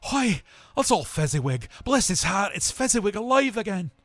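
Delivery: high, loud joyful cry; overjoyed at seeing an old friend; great excitement. A narrator's reading. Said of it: "cried in great excitement".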